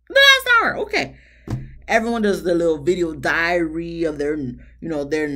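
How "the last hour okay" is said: high, loud cry of disbelief; indignant outburst. Real, not acted. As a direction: blurt it out, loud and high in outrage. Indignant repeat of the long wait.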